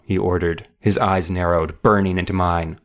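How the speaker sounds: normal talking voice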